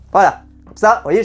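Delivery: ordinary talk, nothing marked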